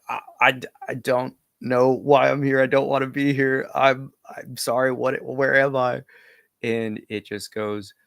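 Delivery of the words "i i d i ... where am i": higher, louder stammering plea, a little strained; frightened fumbling plea. Retold or imitated. Scared tripper begging the looming spirit to let them go, stammering. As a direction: babble in fear, begging to be let go. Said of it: "I'm just fumbling".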